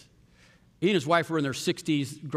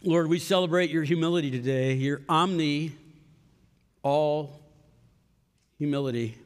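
closing prayer. Acted.

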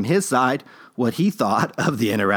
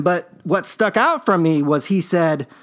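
ordinary talk

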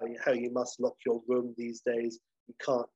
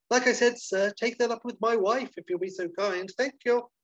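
high, curtly polite brush-off; dismissive hotelier; 10 semitones higher, 3 dB louder than usual. Acted. Basil sending the appraiser to his wife, high forced politeness. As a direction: get rid of him with forced politeness. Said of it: "And Basil's like".

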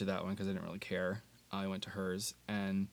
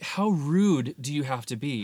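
indignant; 5 semitones higher, 7 dB louder, slower than usual. Retold or imitated. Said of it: "I was like".

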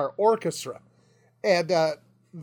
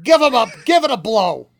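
put-on loud, higher voice of an older New York music teacher; 5 semitones higher, 6 dB louder than usual. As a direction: call out to the flutes like a hammy bandleader.

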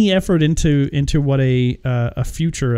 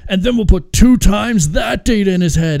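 higher, louder mock sales pitch; pitching movie executive; 6 semitones higher, 5 dB louder than usual. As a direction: sell the silly idea like a suit.

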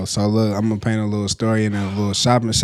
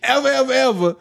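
laughing; 14 semitones higher than usual. Real, not acted. Warning about the bad Subway.

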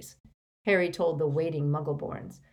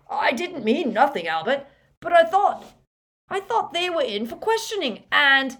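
much higher, flustered pleading; nervous apology; 9 semitones higher, 7 dB louder than usual. A narrator's reading. Balding wizard to Albert, flustered and scared. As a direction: stammer it out anxiously.

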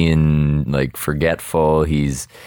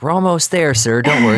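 put-on high, eager helpful boy voice; higher-pitched imitation of a Boy Scout; Boy Scout helper; 9 semitones higher, 3 dB louder than usual. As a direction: play a chirpy scout helping someone along. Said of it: "a Boy Scout who's helping him cross".

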